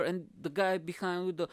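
usual speaking voice

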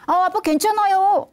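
a hint of a kid saying he's fine; lightly voiced boy. Son answering his father.

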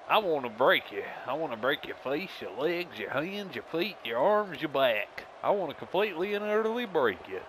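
hammy villain promo, pitch swooping up; over-the-top wrestling heel threat; menacing wrestler. Acted. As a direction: threaten with theatrical relish. Plays Baron Blade's promo.

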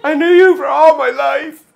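high, loud tearful wail; hammed-up weepy maid of honor; mock crying. Maid of honor giving a wedding speech, crying theatrically. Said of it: "you could be crying and stuff".

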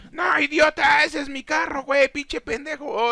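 high-pitched indignant rant; playful outraged yell. Pretend. Gamer sees his car hit in the game, playful outrage. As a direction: protest in a high, exasperated squawk.

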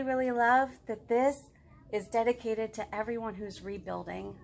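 ordinary delivery

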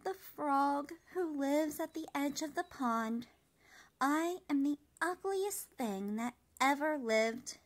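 lightly voiced high little cricket, mournful; a hint of a despairing small cricket; moping cricket. A narrator's reading. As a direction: sigh out the wish slowly.

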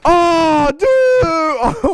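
high excited yelp that cracks; the voice breaks on the excitement. Real, not acted. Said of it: "voice crack".

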